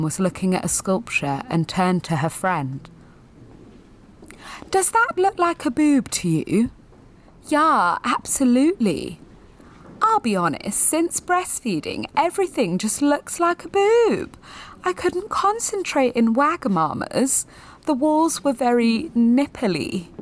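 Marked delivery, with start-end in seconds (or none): 4.7-6.7 higher, breezy yummy mummy voice; a light send-up of a posh mum; mock posh mum. Mums gossiping at a sculpture. 7.5-9.2 higher, breezy yummy mummy voice; a light send-up of a posh mum; mock posh mum. Friend agrees in mum voice. 10.0-20.1 higher, breezy yummy mummy voice; a light send-up of a posh mum; mock posh mum. Mum confides about breastfeeding.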